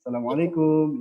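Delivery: "assalamualaikum" jadi "salamalekum" logat kampung; Indonesian village accent. Shown as a demonstration. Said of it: "logat bahasa seperti itu". (logat Indonesia kampung)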